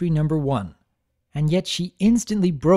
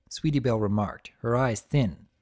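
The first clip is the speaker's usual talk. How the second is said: ordinary delivery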